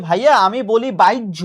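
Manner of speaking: বাইজ্য with an inserted i glide; mock wrong pronunciation. Teacher mimics a student's wrong বাইজ্য. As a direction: imitate a student saying বাইজ্য. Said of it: "এখন কেউ যদি বলে যে".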